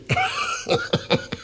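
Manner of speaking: rire aigu et triomphant; éclater d'un rire de vainqueur. Real, not acted. Triomphe seul avec son chat. (un auteur rusé rit de sa réussite, seul avec son chat)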